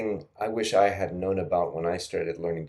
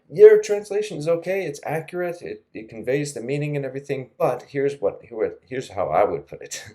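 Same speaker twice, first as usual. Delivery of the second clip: lightly voiced chatbot feedback, higher and louder at the start; quoting the chatbot; 6 semitones higher, 6 dB louder than usual. Teacher quoting the chatbot's verdict, lightly voiced. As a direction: playfully read out the AI's polite critique. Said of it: "it's said".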